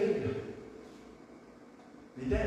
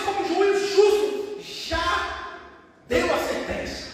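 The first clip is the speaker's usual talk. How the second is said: high, loud, ringing sermon declamation; full-force preaching; 11 semitones higher, 7 dB louder than usual. Acted. Preacher declaring Paul's words to the congregation, full-force preaching. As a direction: preach it at full voice, rising on each question.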